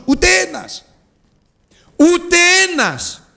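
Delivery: not one, cried out high; high, rising lament; anguished cry. Acted. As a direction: exclaim it in grief. Repeated exclamation.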